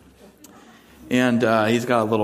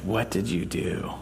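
quiet suspicious question; 3 semitones lower, 8 dB quieter than usual. Retold or imitated. Owner confronting the guilty puppy.

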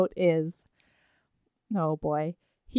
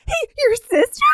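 high, laughing mock glee; mock delighted surprise; 12 semitones higher than usual. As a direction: squeal it like the best news ever. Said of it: "And she goes".

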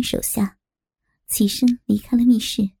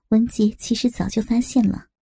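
ordinary delivery, nothing marked

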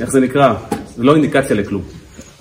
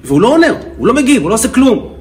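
imitated wife's heated, higher-pitched rant; heated complaint; 7 semitones higher than usual. Wife complains about her silent husband to counselor, heated. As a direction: build a mock exasperated tirade, pitch jumping up. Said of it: "היא אמרה את זה בכזה להט".

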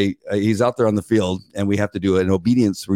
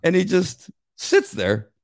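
"And he just sits there" said slowly, higher, drawn out; slow deadpan; 9 semitones higher, slower than usual. Retold or imitated. Telling friend how the dog froze, slow and dry. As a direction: let it hang, slow and stunned. Retold dog refusing command.

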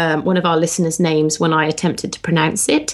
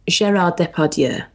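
mock French accent; 2 semitones lower than usual. Shown as a demonstration. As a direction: ham up the French accent on Gérard Depardieu. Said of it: "and you want to say".

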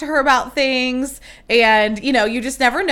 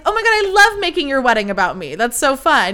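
higher, self-mocking gush; 4 semitones higher, 4 dB louder than usual. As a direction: gush shamelessly, making fun of yourself. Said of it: "what came out of my mouth".

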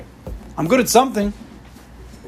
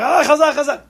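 excited cheer; 11 semitones higher, 3 dB louder than usual. Retold or imitated.

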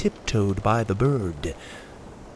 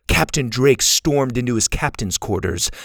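ordinary delivery, nothing marked

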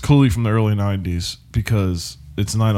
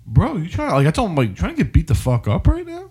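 threatening; 7 semitones higher than usual. Retold or imitated. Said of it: "So I was like".

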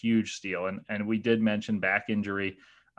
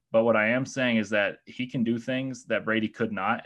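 normal talking voice

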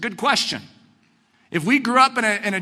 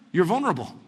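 normal talking voice

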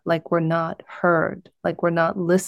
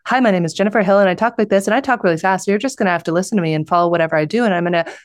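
really fast talking; much faster than usual. Shown as a demonstration. Speaker mimics how they used to give speeches, talking really fast. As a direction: mimic a breathless speed-talker racing through an introduction. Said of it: "I'll try and mimic how I used to speak".

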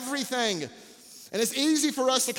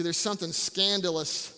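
ordinary delivery

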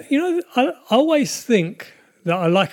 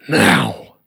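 deep sign-off; 14 semitones lower, 5 dB louder than usual. Acted.

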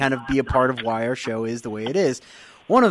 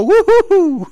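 high, loud "woo-hoo" cheer; hyped party whoop; pumped-up whoop; 10 dB louder, slower than usual. Acted. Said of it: "I'm very pumped right now".

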